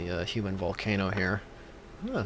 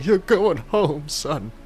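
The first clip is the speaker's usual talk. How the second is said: much higher, slow and louder, hammed-up tearful farewell; mock weeping; 7 semitones higher, 7 dB louder, much slower than usual. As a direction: choke it out like a sobbing parent, overdone. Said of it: "I start crying, like, weep-, weeping madly".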